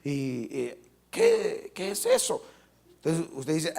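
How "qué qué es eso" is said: squeaky high, baffled imitation of a puzzled believer; hammed-up bewildered question; mock surprise. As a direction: play a startled newcomer in a high voice.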